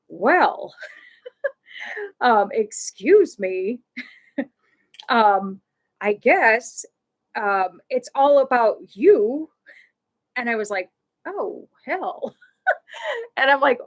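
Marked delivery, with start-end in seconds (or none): 0.1-9.5 slow, pointed passive-aggressive complaint; angry voice. Retold or imitated. Stranger in the ice cream line scolds the speaker, mock angry. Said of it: "this angry voice". 11.3-12.4 higher, softer "uh-oh" reaction; dismayed aside, pitched up and hushed; retold dismay. Speaker reacts to the angry voice behind her, dismayed.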